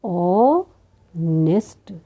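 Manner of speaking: very slow ऑनेस्ट, long opening "o", then "nest"; stretched teacher's ऑनेस्ट, vowel first, pause before "nest"; sounded out. Shown as a demonstration. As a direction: split ऑनेस्ट in two with no h, drawn right out. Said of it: "कैसे पढ़ेंगे".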